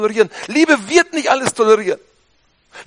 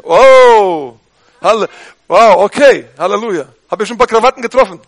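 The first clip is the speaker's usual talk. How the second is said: normal talking voice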